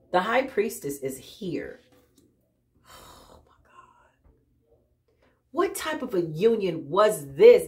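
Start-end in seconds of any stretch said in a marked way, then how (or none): 2.8-4.1 breathy whispered oh my God; hushed awe; awed whisper. Real, not acted. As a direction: barely breathe it out. Awed reaction to card.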